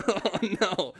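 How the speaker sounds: retold dismay. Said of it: "and I'm like".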